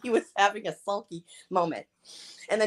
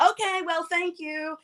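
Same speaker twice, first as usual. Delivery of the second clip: high, softer imitation of huffy thanks; mock huffy; 7 semitones higher, 6 dB quieter, slower than usual. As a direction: mimic a sulky goodbye. Mimicking his huffy exit.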